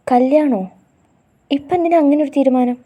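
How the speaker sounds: jolted by the news of her wedding; alarmed, pushing for an answer; shocked disbelief. A narrator's reading. Shocked boyfriend hears of wedding.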